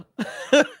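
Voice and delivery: high-pitched laughing fit; burst of laughter. Real, not acted. Laughing at a Twitter clip, high-pitched cackle. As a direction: crack up helplessly at the clip.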